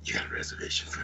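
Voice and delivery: whispery, hushed character voice; put-on phone voice. Shown as a demonstration. Showing off a voice for making reservations, whispered and put-on. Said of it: "Yeah, I'm Batman".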